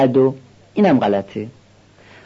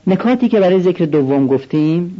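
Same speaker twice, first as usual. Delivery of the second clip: ordinary talk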